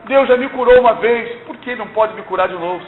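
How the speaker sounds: ordinary delivery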